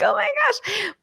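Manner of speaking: high, soft mock exclamation; retold shock. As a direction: squeak it quietly in playful disbelief. Retelling reaction to going viral.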